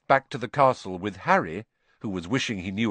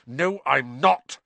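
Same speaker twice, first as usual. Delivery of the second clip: slow, higher, firm denial; angry; 5 semitones higher, 3 dB louder, slower than usual. A narrator's reading. Harry denying Malfoy's taunt, angrily. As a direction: deny it crossly, word by word. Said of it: "said Harry angrily".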